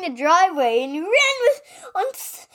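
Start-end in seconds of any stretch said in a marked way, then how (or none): none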